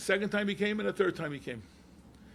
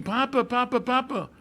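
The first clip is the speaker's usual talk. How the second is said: retold scream; 4 semitones higher, 5 dB louder than usual. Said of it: "He's screaming".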